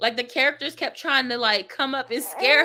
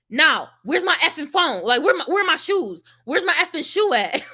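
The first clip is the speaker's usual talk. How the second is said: louder, higher furious rant about lost shoes; angry cussing; 5 semitones higher, 5 dB louder than usual. Retold or imitated. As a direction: rant at the dark for your shoes.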